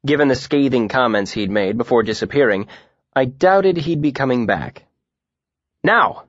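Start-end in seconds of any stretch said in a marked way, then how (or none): 5.8-6.2 sudden high shout to open class; abrupt call for attention. A narrator's reading. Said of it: "Elodin shouted without preamble".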